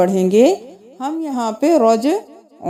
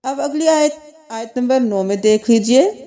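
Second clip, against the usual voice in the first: ordinary talk, nothing marked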